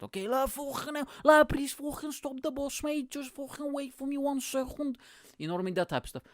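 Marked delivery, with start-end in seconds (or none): 0.3-5.0 high-pitched, hammed-up Scouse; over-the-top Scouse lad calling out; crazy Scouse accent. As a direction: plead for the bus in exaggerated Scouse. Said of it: "with the craziest Scouse accent".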